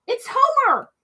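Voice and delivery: delighted to spot the name; excited. Real, not acted.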